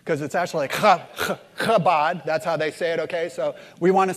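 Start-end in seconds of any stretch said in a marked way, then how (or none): 0.7-2.2 "kabod" begun with a rasping "ch" from the throat; throaty Hebrew pronunciation. Shown as a demonstration. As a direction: scrape the first sound of "kabod" in the throat. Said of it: "Prepare your throats, 'cause it's actually like".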